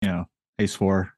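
ordinary talk

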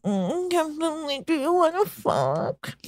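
put-on high, soft, slow drunken slur; imitating a tipsy flirt; drunk come-on. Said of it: "Helen and her friend are drunk".

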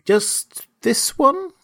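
put-on high, slow, childlike plea; pleading cry. A narrator's reading. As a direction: beg like a little boy, drawing it out. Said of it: "cried Lionel".

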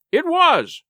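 high-pitched eager confirmation; excited cry. A narrator's reading. Silver confirming the blind man's name, excited cry. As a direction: jump on the answer. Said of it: "cried Silver, now quite excited".

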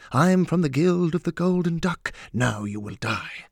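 hammed-up villain announcement, high then dropping; mock assassin. Atticop ridicules assassins announcing themselves, mock-menacing.

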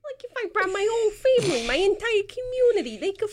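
a cocky squeaking rat; squeaky rat voice. Acted. DM voicing the rat.

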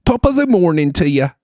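high, singsong Irish brogue; jovial Irish accent. Acted. Host showing off his jovial Irish greeting, high Irish accent. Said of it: "your Irish guy is, like, the jovial guy".

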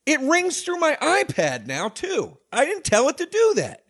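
high-pitched, incredulous complaint; real high-voiced exasperation; exasperated bafflement. Real, not acted. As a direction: protest helplessly at misbehaving gadgets. Baffled by phone ringing through TV.

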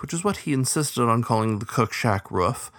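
ordinary delivery, nothing marked

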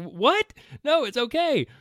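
high, light voice, breezy and unfazed; mock wife reply. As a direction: play the wife brushing it off cheerfully. Wife answers his divorce call.

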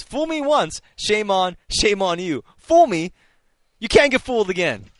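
hammed-up high and loud, fumbling the saying; mangled Bush quote. Host botching a George Bush saying on air, hammed-up and high. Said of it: "I can't do the, uh, George Bush impersonation".

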